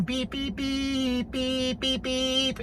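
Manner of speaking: high, soft beep beep like a moped horn; high soft horn noises made with the voice; imitated horn beeps. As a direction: mimic a little scooter horn.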